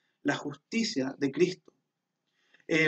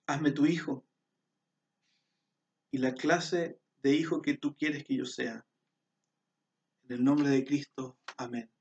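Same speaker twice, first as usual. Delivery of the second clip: hushed closing prayer. Acted. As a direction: pray it aloud, leading the listeners. Leads a prayer aloud.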